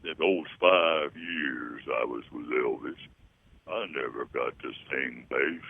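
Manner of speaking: low, slow bass lament; slow deep bass complaint. Retold or imitated. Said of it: "He said".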